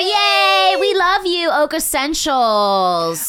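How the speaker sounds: high, loud cheer; overwhelmed surprise. Real, not acted. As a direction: squeal with delight for the sponsor. Cheering for the first sponsor.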